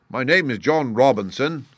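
high-pitched evasive answer; evasive little man. A narrator's reading. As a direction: offer a false name a little too readily. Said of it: "he answered with a sidelong glance".

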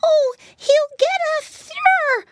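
loud, very high drawn-out wail; acted piglet squeal of dread. Flop chased by the alligator, high loud wail. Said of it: "wailed Floppy".

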